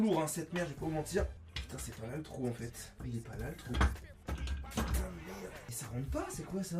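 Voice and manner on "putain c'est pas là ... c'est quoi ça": cursing under breath. Real, not acted. Muttering while assembling.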